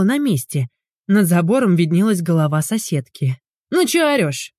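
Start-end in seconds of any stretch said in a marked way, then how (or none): 3.7-4.5 high grumpy rebuke; displeased. A narrator's reading. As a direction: grumble at her sharply. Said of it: "недовольно спросила она".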